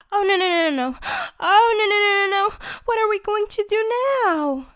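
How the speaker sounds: high, loud panicked wail; desperate. Acted. Chubby panicking once he learns they are trapped.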